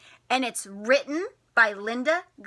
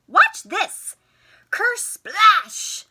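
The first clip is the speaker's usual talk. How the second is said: high, loud playful splash; excited splash; 9 semitones higher, 9 dB louder, much slower than usual. A narrator's reading. Teacher acts the puddle splash for children, loud and high. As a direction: make the splash big and fun.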